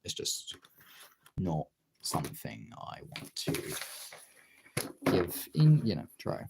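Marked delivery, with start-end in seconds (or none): none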